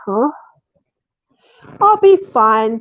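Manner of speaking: ordinary talk, nothing marked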